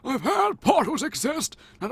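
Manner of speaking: put-on high, quavery old man voice. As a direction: croak it like an old sage. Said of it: "my stereotypical old man voice".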